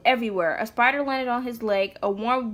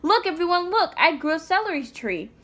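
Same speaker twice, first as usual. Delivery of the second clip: high, louder excited shout; 7 semitones higher, 4 dB louder than usual. A narrator's reading. Greta shouting to classmates about her celery, loud and proud. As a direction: call out proudly to the whole class. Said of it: "and shouted".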